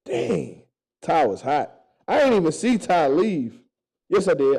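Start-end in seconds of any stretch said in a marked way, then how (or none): none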